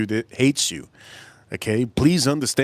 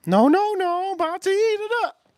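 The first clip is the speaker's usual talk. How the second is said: very high put-on Tennessee aunt voice; squeaky mock-Southern aunt insisting it is catfish. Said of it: "this is how she talks".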